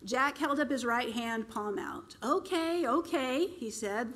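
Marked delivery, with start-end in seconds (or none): 2.2-3.5 mild raised voice. Acted. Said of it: "voice raised to be heard over the clamor".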